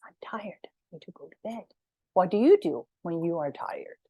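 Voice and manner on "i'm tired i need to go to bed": whisper. Shown as a demonstration. Teacher whispers bedtime words.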